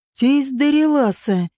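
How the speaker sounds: drawn out slowly, with a smile; slow smiling drawl. Acted. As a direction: stretch the name out with a smile. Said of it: "медленно и с улыбкой протянул он".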